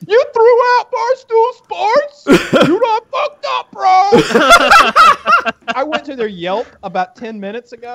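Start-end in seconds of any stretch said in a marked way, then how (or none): 0.1-4.1 high, slow jeering bro voice; mocking bro voice. Diners on the next patio taunting him, high mock bro voice. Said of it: "they're like". 4.1-5.7 high burst of laughter. Real, not acted. Telling friends the story, a high burst of laughter.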